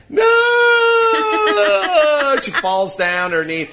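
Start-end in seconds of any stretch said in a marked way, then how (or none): 0.1-2.4 high, loud drawn-out wail; mock child scream. Daughter collapses near the top, loud high mock wail. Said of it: "And she goes".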